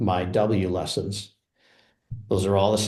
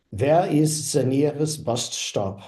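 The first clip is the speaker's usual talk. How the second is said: slow, higher-pitched English with a German accent; fake German accent; 5 semitones higher, slower than usual. Shown as a demonstration. Learner trying the sentence after the AI voice, in a fake German accent. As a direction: sound out each word carefully in a put-on German accent. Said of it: "fake German English accent".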